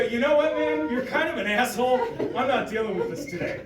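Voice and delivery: loud, laughing put-down; retold blunt scolding, half laughing; telling him off. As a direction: call the customer out with a grin. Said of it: "I go".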